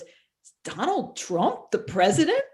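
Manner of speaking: bewildered retold question; confused. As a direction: ask it in drawn-out, incredulous confusion. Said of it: "he looks really confused".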